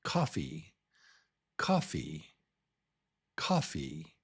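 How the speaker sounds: "coffee" as COF-fee, second half dropping; first-syllable stress. Shown as a demonstration. Teacher models a similar word, "coffee" stressed up front. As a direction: hit the front of "coffee", let the end fall. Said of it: "the very similar one".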